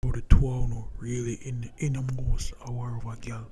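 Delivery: usual speaking voice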